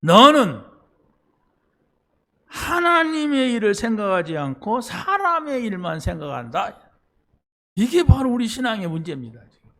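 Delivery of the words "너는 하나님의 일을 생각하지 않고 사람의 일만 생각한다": stern rebuke. Retold or imitated. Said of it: "예수님이 이렇게 말합니다".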